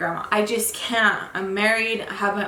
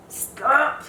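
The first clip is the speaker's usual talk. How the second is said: ordinary talk, nothing marked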